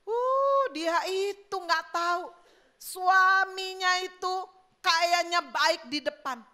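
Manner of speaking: high, drawn-out gossip voice; penggosip. Acted. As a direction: dish the dirt with relish. Penggosip menjelekkan suami orang. (nada gosip, tinggi dan dipanjangkan)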